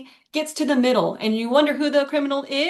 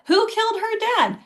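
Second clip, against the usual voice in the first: higher, indignant disbelief; shocked question; 11 semitones higher than usual. Retold or imitated. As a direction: demand an answer, stunned. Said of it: "she asks in disbelief".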